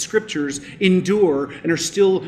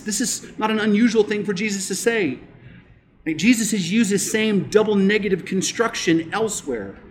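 normal talking voice

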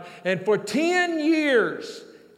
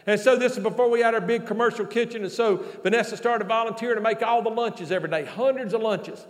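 ordinary delivery

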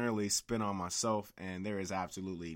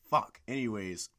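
ordinary talk

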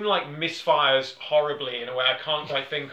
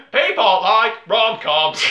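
slow, laughing mock decree; laughing mock pitch; 6 semitones higher, 9 dB louder, slower than usual. Podcaster mocks the Netflix algorithm, laughing slowly. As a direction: announce it slowly while cracking up.

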